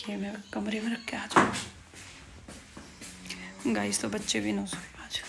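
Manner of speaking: soft unvoiced whisper; hushed late-night whisper. Real, not acted. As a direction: whisper to the camera so the house stays asleep. Night, others sleeping.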